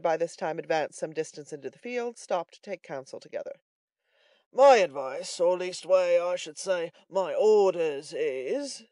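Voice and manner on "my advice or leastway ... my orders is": loud, weighty character voice; fattest man. A narrator's reading. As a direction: pronounce it like orders, slowing at the end. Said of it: "said the fattest man of the party".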